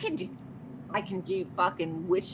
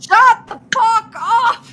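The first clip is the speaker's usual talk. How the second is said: shout; 12 semitones higher, 8 dB louder, slower than usual. Real, not acted. Drunk outburst at co-host.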